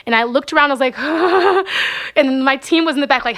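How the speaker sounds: normal talking voice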